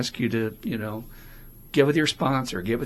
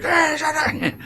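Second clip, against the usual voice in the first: much higher, louder yelled shut up; shout at the critic; 8 dB louder, slower than usual. Shown as a demonstration. Speaker showing how he yells at his inner critic in the car, loud high shout. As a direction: yell it at your inner critic. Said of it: "in the car and just like".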